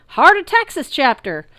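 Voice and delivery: Texas twang, yelled loud and high; twangy Texas yell. A narrator's reading. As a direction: holler back in a Texas twang. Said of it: "a twangy voice yelled back".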